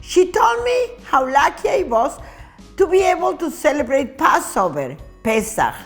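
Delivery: plain reading voice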